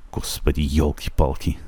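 half-hearted mock sigh of dismay; mock exasperation. Shown as a demonstration. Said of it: "اگه کسی بخواد بگه ای بابا".

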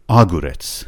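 آگورتس with the weight on opening آ; stress on first half. Shown as a demonstration. Teacher showing a hypothetical wrong stress, آگورتس stressed up front. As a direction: hit the first syllable of آگورتس.

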